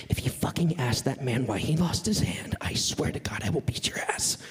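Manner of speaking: hushed threatening mom voice; a hint of an angry mother hissing a threat; mock stern mom whispering; mom's threat. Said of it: "I could hear my mom's voice".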